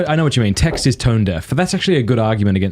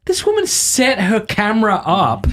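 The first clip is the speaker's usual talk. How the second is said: mock incredulous; 10 semitones higher than usual. Real, not acted. Mocking a staged Instagram video.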